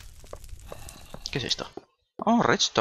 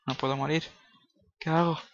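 usual speaking voice

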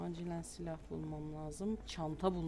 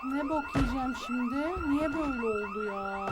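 panicked; 9 semitones higher, 8 dB louder than usual. Real, not acted. Trapped by zombies, panicking.

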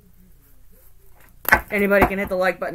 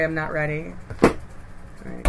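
ordinary delivery

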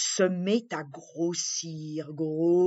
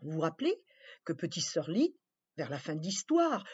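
plain reading voice

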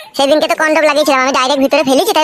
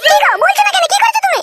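much higher woman's voice, scolding; a much higher woman's voice, scolding and accusing; shrill village woman. Acted. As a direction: play the wife lashing out at the girl. Female character again.

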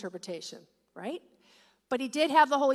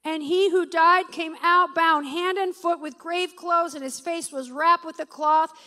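loud, declaiming pulpit reading; preaching a scripture; 3 semitones higher, 8 dB louder than usual. Acted. Preacher reading the raising of Lazarus aloud, loud and declaiming. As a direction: proclaim the miracle to the room.

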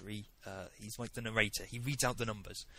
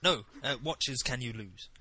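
ordinary talk

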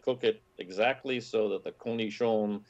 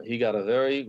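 ordinary delivery

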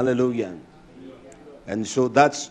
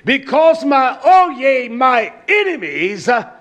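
raised, fervent recitation; preacher reciting scripture with fire; impassioned scripture reading; 9 semitones higher, 3 dB louder, slower than usual. Acted. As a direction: cry out the verse with passion.

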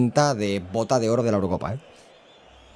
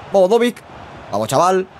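shouted cheer, much higher and louder; excited cheer; 7 semitones higher, 4 dB louder than usual. Real, not acted. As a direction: cheer the player on. Cheering a near chance. (grito de ánimo)

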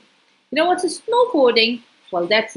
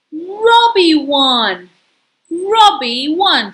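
loud and slow, stretched out; emphatic announcement; 4 semitones higher, 6 dB louder, much slower than usual. Real, not acted. Reader telling child the race result, loud and slow. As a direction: proclaim the winner slowly.

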